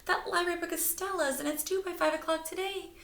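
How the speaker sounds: high, urgent voiced mother; mother's voice. A narrator's reading. Mother explaining to the scouts, high voice. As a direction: explain it in a hurry.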